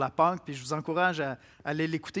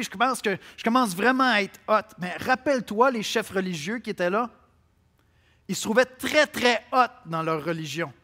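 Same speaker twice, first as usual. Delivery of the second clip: louder and higher preacher's warning; fervent preaching; 4 semitones higher, 5 dB louder than usual. Acted. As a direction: press the warning with rising force. Preacher builds against moralism. (prédication fervente et plus forte)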